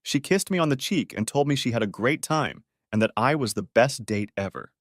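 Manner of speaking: ordinary delivery, nothing marked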